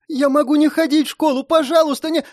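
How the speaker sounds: put-on very high, urgent child's voice; pleading little boy. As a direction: beg desperately as a small boy. Boy begs for his mother. (умоляющий детский голос)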